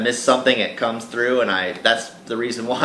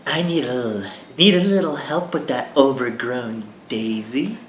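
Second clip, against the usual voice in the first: lightly higher sultry voice; sultry woman; 5 semitones higher than usual. Acted. Seductive stranger offering help, lightly sultry. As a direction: purr the request. Voicing female character.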